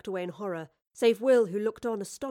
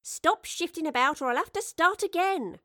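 higher scolding voice of a wardrobe mistress; chiding; 9 semitones higher, 3 dB louder than usual. A narrator's reading. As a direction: tell the fidgeting boy off. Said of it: "Mary chided".

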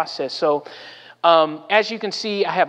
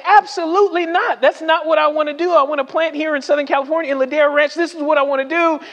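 high-pitched, indignant protest, retold; playacted adamant objection; emphatic refusal; 9 semitones higher, 3 dB louder, faster than usual. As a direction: dig your heels in, voice raised in pitch. Acted refusal of job offer.

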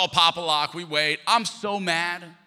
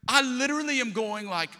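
ordinary talk

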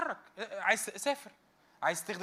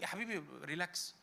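reassuring; 9 dB quieter than usual. Acted. Preacher soothing a worried listener.

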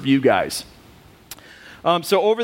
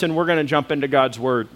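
ordinary talk, nothing marked

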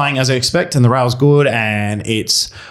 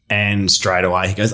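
usual speaking voice

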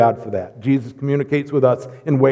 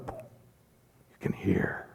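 awed whisper; 7 semitones lower, 15 dB quieter, much slower than usual. Acted. Awe at healing the deaf.

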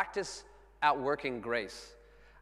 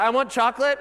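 high, loud mock-child shout; clamouring kid; 10 semitones higher, 14 dB louder than usual. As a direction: imitate a kid demanding a treat. Excited kids clamouring, imitated.

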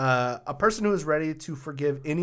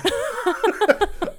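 a high, bursting squeal of a laugh; high squeal of laughter; 5 dB louder than usual. Real, not acted. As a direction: let out a shrill giggle. Laughing at prozvoniting.

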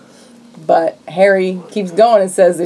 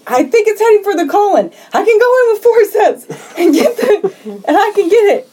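mock urgency; 14 semitones higher than usual. Acting doctor's urgent proposal.

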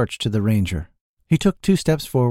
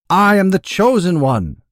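loud, high-pitched shout; shouted boast; 9 semitones higher, 7 dB louder than usual. A narrator's reading. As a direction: shout it out like a rival claiming glory. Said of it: "they both shouted simultaneously".

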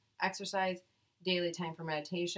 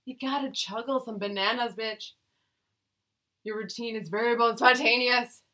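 sassy; 4 semitones higher, 7 dB louder than usual. Pretend. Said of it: "No, keep going".

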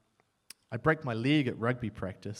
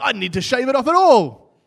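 louder, higher mock panic; mock alarm; 9 semitones higher, 14 dB louder than usual. Applicant seeing dreadlocked interviewer, mock alarm. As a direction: blurt it out in comic dismay. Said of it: "I'm like".

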